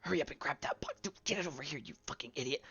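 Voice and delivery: hushed, bickering smugglers; bickering voices. Acted. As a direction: hiss orders at a clumsy partner. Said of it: "sounds kinda like some arguing voices".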